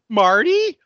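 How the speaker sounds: high, hammed-up delighted surprise; a ditzy girl greeting a photo; mock surprised greeting. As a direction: gush at an old friend. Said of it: "She's like".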